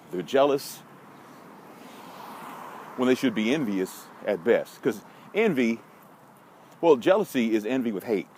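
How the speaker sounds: ordinary talk, nothing marked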